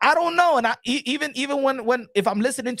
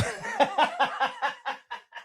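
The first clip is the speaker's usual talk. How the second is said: breathy, trailing run of laughter; real wheezy laugh; long laugh; 7 semitones higher, 6 dB quieter than usual. Real, not acted. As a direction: crack up at your own chant. Laughs at own chant.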